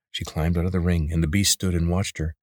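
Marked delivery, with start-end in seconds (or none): none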